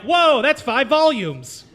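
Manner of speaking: high, loud and slow, hamming up the amazement; amazed whoa. Retold or imitated. Comic bragging to the crowd about his measurement, loud slow amazement. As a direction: stretch it out like a proud discovery. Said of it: "I'm like".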